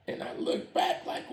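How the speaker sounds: ordinary delivery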